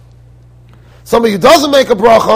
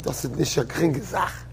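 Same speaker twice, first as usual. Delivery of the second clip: grave rabbi; 6 semitones lower, 13 dB quieter than usual. Retold or imitated. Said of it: "Rav Elyashiv said".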